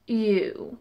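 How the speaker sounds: low, soft, brief impression; Captain Jack Sparrow. Showing off a [name]-as-pirate impersonation, lightly done. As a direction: lightly slip into a tipsy pirate voice. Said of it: "that's my Captain Jack Sparrow impersonation".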